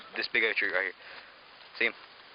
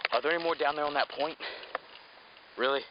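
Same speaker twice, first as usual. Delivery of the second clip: usual speaking voice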